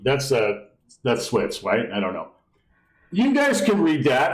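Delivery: ordinary talk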